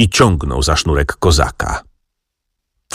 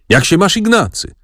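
high, bright mock-hearty call; joyful greeting; 11 semitones higher than usual. A narrator's reading. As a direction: greet an old friend with open arms. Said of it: "zawołał, padając mi w objęcia". (radosne powitanie z wysokim głosem)

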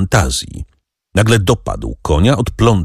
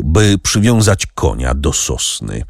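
usual speaking voice

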